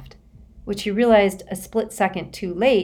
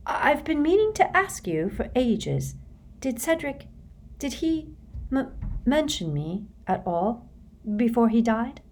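much higher, halting and fragile; 7 semitones higher, 4 dB quieter than usual. A narrator's reading. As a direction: lift the voice high and unsteady. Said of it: "Cho's voice was rather higher".

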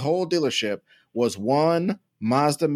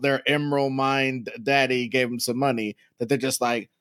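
ordinary talk

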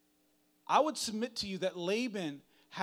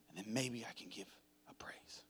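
breathy hushed whisper, fading out; a secret whispered to the room; 7 semitones lower, 12 dB quieter than usual. Acted. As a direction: let the words dissolve into a whisper. Trailing into a whispered hush.

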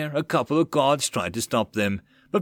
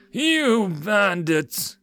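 high, drawn-out accusation; crying out; 9 semitones higher, much slower than usual. Acted. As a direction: cry out in slow, high indignation. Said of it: "the merchant cried out".

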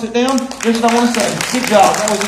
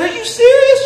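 high, loud, laughing "Are you serious?"; shocked disbelief; 13 semitones higher, 5 dB louder than usual. Retold or imitated. Pastor retelling his amazement to the church, laughing in disbelief. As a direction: squeal it out in delighted shock. Retold shocked reply to wife.

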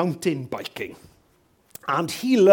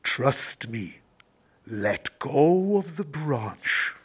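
slow, low, stately divine voice; voice from the heavens; 3 semitones lower, much slower than usual. Acted. God answers man on the branch, slow deep heavenly voice. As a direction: intone it slowly as God. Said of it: "voice comes back from the heavens".

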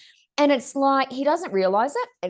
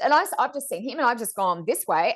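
ordinary delivery

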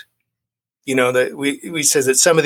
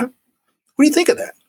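sponsor's quizzing question, pitched much higher; imitating his sponsor; 13 semitones higher than usual. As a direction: play the sponsor springing a test question. Sponsor quizzing him in story.

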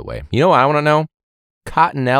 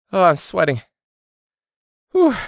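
ordinary delivery

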